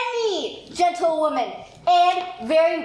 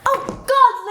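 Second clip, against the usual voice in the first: exasperated outburst; 7 semitones higher, 7 dB louder than usual. Acted. Nurse exasperated at Juliet.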